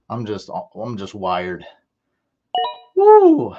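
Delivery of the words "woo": high loud cheering woo; big jubilant holler; excited whoop. Real, not acted. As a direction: let out a victory whoop. Cheering at a pick.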